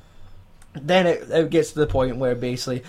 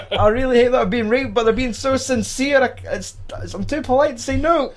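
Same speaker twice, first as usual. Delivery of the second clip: higher, mock-earnest squeaky voice, hammed-up; mock polite victim; 9 semitones higher than usual. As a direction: play a victim too polite to object, higher. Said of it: "he would be like".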